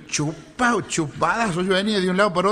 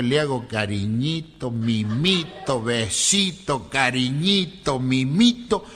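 low, slow, drawn-out coddling; cooing tenderness; 5 semitones lower, much slower than usual. Acted. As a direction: stretch each little endearment lovingly. Friend acts out coddling his wife. (mimoso, lento y grave)

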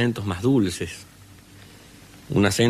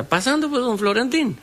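put-on old healer greeting; 14 semitones higher than usual. Samaniego greets sick Florentín at the gate, high rustic voice. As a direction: hail the visitor from the doorway.

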